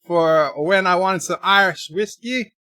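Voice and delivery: lively mock Irish hologram servant; amateur stage-Irish accent. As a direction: put on a hammy Irish brogue. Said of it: "my emergency Irish hologram".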